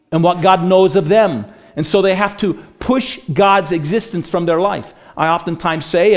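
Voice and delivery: rising pulpit intensity; preaching at full force. Acted. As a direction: build the sermon back up with urgency. Preaching builds again.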